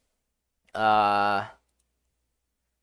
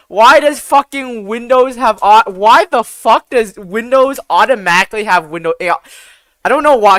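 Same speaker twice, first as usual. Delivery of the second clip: louder, higher, frustrated rant; exasperated; 6 semitones higher, 7 dB louder than usual. Real, not acted. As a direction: raise your voice in real exasperation. Exasperated rant about Windows audio settings.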